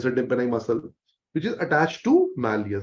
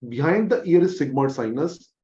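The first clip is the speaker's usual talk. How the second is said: ordinary talk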